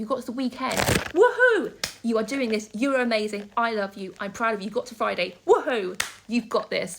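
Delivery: loud, whooping encouragement; rousing cheer. Real, not acted. Vlogger cheering struggling viewers to the weekend, exuberant and loud. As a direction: cheer them on at full volume.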